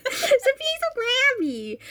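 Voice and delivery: high, drawn-out mock whine. Echoing the co-host about grabby Kathy, mock whine. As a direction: stretch it out in a high voice. Mockingly echoes co-host's telling-off.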